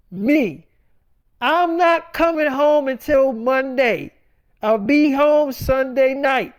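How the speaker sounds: firmly dictating terms; stern. Retold or imitated. Retold argument with wife Tanera.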